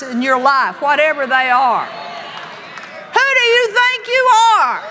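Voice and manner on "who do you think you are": defiant shout. Acted. Preacher confronting life's giants.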